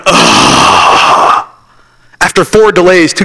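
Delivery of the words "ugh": low, breathless groan of disgust; crowd groan. Retold or imitated. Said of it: "everybody in there went".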